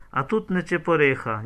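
ordinary delivery

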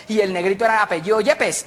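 usual speaking voice

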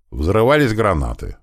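plain reading voice

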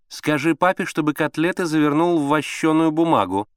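insistent high-pitched instruction; demanding mother. A narrator's reading. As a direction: give orders from the next room. Mother ordering from the bedroom.

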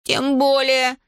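mock-menacing boy, pitched high. A narrator's reading. Schoolboy warns classmates before a fight, menacingly. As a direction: threaten in a high boyish voice. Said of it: "грозно проговорил Иван".

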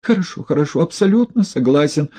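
high, hurried agreement; rattling off. A narrator's reading. Одутловатый торопливо соглашается с Егоровым. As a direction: rattle it off eagerly. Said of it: "затараторил одутловатый".

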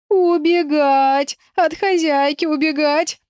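high, drawn-out wailing confession. A narrator's reading. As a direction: whine each word out long and pleading. Frightened raccoon keeps wailing promises. (протяжно, плаксиво)

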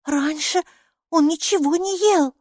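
put-on high little-girl voice; remarking child. A narrator's reading. Майки defends the pocket, high child voice. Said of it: "заметила Майки".

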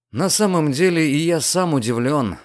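ordinary delivery, nothing marked

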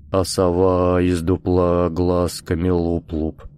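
slow, put-on hoarse recital. A narrator's reading. Hoarse voice from memory reciting a children's song, husky. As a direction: recite the nursery rhyme slowly in a husky voice. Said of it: "хриплый голос, произносящий слова детской песенки".

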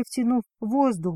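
plain reading voice

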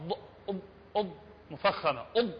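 ضاد مفخمة; heavy emphatic ض. Shown as a demonstration. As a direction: show the full emphatic ض sound.

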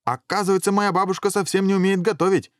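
high-pitched, indignant dragon head; crying out. A narrator's reading. Dragon head complaining to everyone, high crying out. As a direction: keep the complaint up high throughout. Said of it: "закричала голова Иван".

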